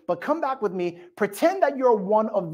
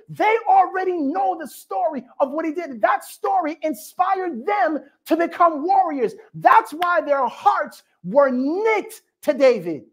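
higher, intense delivery; fervent preaching; 6 semitones higher than usual. Acted. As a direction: drive the point home. Preaching climax.

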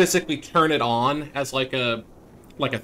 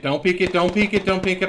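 higher, pressing repeated command; urgent warning; 5 semitones higher than usual. Real, not acted. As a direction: insist fast, rising urgency.

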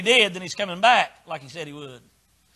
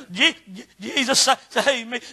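stammered, choked imitation of crying; broken syllables between fake sobs; mock sobbing; 2 semitones higher than usual. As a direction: imitate a weeping convert struggling for words. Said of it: "crying so hard they can't even talk".

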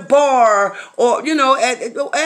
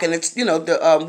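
ordinary talk

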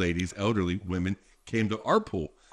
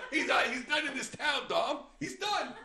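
laughing; 10 semitones higher, 7 dB quieter than usual. Acted. Laughing mock banishment.

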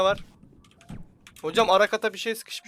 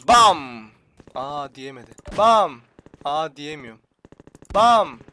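imitated gunshot; 3 semitones lower, 11 dB louder, much slower than usual. Silah sesi taklidi.

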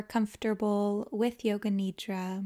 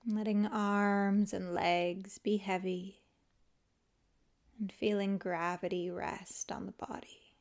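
calm, unhurried yoga nidra guidance with long pauses; guided meditation; 3 dB quieter than usual. Acted. Teacher guiding listeners to let the body melt, calm guided meditation. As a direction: ease them into rest, leaving space after each phrase.

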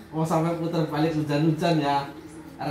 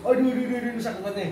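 mock pain; 6 semitones higher, much faster than usual. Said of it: "Kayak nendang Papa gitu".

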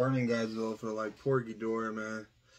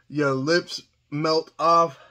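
slow; 5 dB louder, much slower than usual. Real, not acted. Burning lips.

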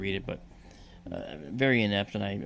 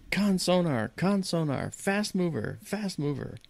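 sonar tech yelling; 8 semitones higher, slower than usual. Retold or imitated. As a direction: shout the alert across the control room.

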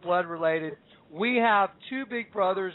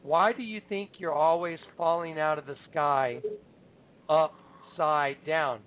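slow, deliberate delivery with long pauses; measured, weighty quote, pausing before "upside down"; the Lord's words; 3 semitones lower, slower than usual. Retold or imitated. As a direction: draw out "upside down" word by word. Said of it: "I heard the Lord say to me".

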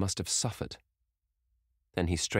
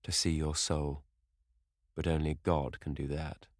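Hallward tells Dorian only God sees souls, sorrowfully; deep-toned sorrow. Acted. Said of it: "with a deep-toned sorrow in his voice".

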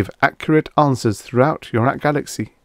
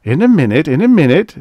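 brushing him off, louder and higher; impatient; 7 semitones higher, 6 dB louder than usual. A narrator's reading. Bates brushing off Farnham, impatient. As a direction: wave him away, impatient. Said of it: "said Bates impatiently".

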